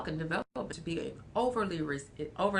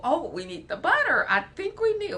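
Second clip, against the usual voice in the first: high, loud little kid pretending to bake; bubbly toddler playing chef; a child's excited make-believe; playful child voice; 11 semitones higher, 9 dB louder than usual. Child voice baking with eggs.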